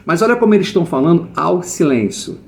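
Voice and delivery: ordinary delivery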